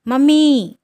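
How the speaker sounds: loud, high scream of Mummy. A narrator's reading. Daughter screams for her mother after the book is thrown. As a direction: yell for her mother so the house shakes. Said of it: "ఇల్లు అదిరిపోయేలా అరిచింది".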